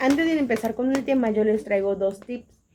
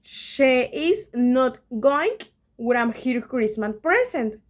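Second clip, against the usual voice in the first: slow English example read; much slower than usual. Shown as a demonstration. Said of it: "Esta sería mi oración".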